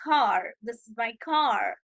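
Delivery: slow American "car" with a full r; American accent. Shown as a demonstration. Teacher shows how Americans say car, American accent. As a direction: draw out "car" and sound the American r. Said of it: "American इसे कहते हैं".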